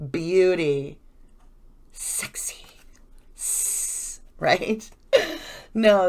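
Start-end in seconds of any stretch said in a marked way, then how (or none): 1.9-4.2 an unvoiced, sultry whisper; teasing whisper. Tarot reader to viewers, a teasing whisper about the card. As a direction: breathe the word out like a secret.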